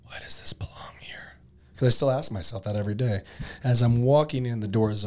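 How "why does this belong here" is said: breathy unvoiced whisper. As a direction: whisper it close to the mic. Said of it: "whisper it".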